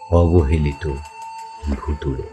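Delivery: ordinary delivery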